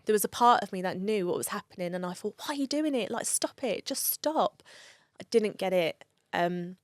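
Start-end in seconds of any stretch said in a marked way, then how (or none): 2.4-4.6 higher-pitched, retold frustrated pleading; exasperated plea. Daughter begging her drinking dad to stop, retold exasperation. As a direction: beg him to stop, as a remembered plea.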